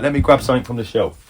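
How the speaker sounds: ordinary talk, nothing marked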